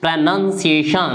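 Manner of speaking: slow teacher demo of प्रोनंसिएशन with a short "nun"; careful model pronunciation. Shown as a demonstration. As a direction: say प्रोनंसिएशन slowly as pro-nun-si-e-shun, no "aun". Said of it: "प्रोनंसिएशन ध्यान दीजिए". (प्रोनंसिएशन को धीरे, "नन" के साथ बोलें)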